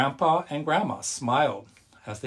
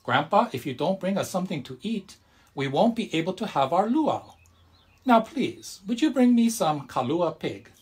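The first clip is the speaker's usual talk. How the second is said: higher, insistent plea; pleading grandma; 6 semitones higher, 4 dB louder than usual. A narrator's reading. Grandma pleads with grandpa for the luau food, high. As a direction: press him to bring food.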